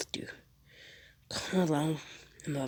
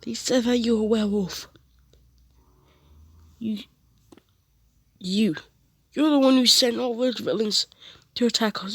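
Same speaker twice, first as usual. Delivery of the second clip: higher, louder accusation, cooling toward the end; accusing shout; 6 semitones higher, 4 dB louder than usual. Retold or imitated. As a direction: point the finger and accuse. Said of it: "and he's like".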